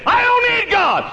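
very high, shouted mock boast; mocking the proud. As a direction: throw the scoffer's words back. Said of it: "you say".

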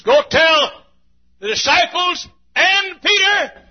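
high, loud, slow proclamation; proclaiming call. Acted. The angel telling the women, loud and high. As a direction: ring it out like a herald. Risen Lord's message to the ladies.

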